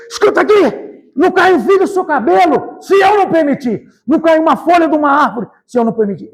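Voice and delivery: high, booming divine proclamation; thunderous voice. Retold or imitated. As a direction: thunder it out as God's own decree. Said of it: "com a voz grande, como um trovão".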